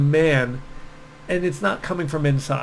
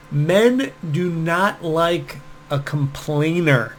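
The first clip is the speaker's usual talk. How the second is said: ordinary delivery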